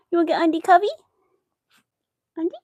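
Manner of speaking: high, loud cooing question. Real, not acted. Owner offers her dog the blanket, high baby talk. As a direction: coo it at the puppy.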